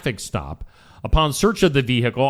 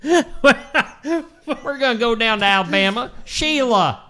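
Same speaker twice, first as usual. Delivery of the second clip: put-on Alabama accent; 5 semitones higher than usual. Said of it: "I need to be doing an Alabama accent".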